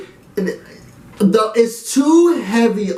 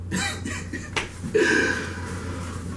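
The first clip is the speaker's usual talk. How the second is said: airy laugh; 11 dB quieter than usual. Real, not acted. Heated about the show, laughing.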